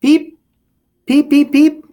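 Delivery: very high loud peeps; peeper call. Acted. The peeper frog calling in the story, very high and loud. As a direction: chirp like a tiny frog.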